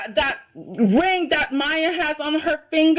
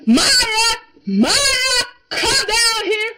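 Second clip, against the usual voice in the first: higher, louder call for Maya up the stairs; 7 semitones higher, 4 dB louder, much slower than usual. Retold or imitated. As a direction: shout for Maya to come down, upset. Upset son calling out to Maya.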